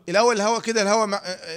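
ordinary talk, nothing marked

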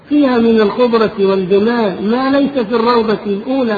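high, loud preacher's narration; full-force sermon storytelling. A narrator's reading. Preacher recounts the gardens of the dream, loud impassioned storytelling. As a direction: project each phrase of the tale with rising fervour.

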